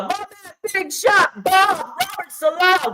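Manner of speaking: high, slow, drawn-out hype call; hyped greeting. Real, not acted. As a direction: greet him like a hero coming home. Pretend calling the coach.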